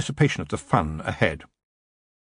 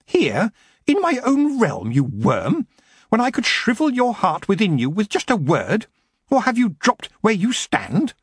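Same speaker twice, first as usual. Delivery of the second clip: furious queen; 12 semitones higher, 3 dB louder than usual. A narrator's reading. Queen to threatening goblin, furious. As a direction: let each word drip with fury.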